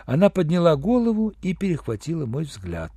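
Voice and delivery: plain reading voice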